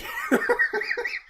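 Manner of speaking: a sudden high laugh; high burst of laughter. Real, not acted. Host cracks up at the Discord joke, laughing.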